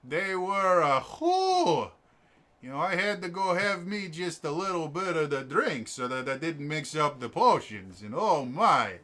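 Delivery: jolly Upper Michigan Yooper character voice; Yooper accent. Acted. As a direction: play a tipsy Yooper potion maker. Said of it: "you guys are going to meet a U P er".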